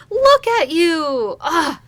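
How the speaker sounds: high, loud, stretched-out gushing; delighted exclamation. Acted. Captain greets her former student, high and thrilled. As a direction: gush over an old friend, slow and loud.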